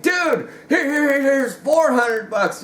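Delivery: a hint of a desperate buyer waving cash; eager buyer. Eager buyer begging for gun.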